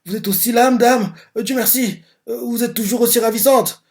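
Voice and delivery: a hint of tearful high voice; still crying. Gigi greeting the old lady through tears, lightly voiced high crying. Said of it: "Il continue à pleurer".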